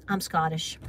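low, quiet, deadpan quote; lightly imitated James. Mother quoting James's stock answer, lightly imitated, low and quiet. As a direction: lightly mimic him, dropped low and soft. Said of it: "James is like".

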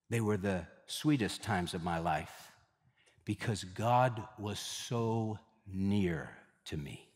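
low, slow and very soft; hushed and tender. Retold or imitated. Missionary's testimony to her church, low, hushed and tender. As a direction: let it sink to a near whisper of gratitude.

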